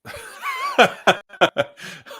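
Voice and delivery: high, loud burst of laughter; amused laugh. Real, not acted. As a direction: laugh out loud at the co-host's joke. Amused by co-host's peanut butter joke.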